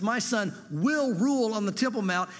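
ordinary talk, nothing marked

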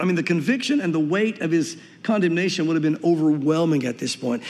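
ordinary delivery

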